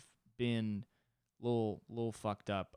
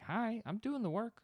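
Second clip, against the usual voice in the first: put-on baby talk; 14 semitones higher than usual. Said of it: "I'd look at 'em and be like".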